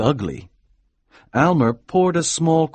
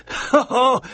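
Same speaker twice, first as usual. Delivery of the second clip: mock laugh; 13 semitones higher than usual. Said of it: "said Aminadab".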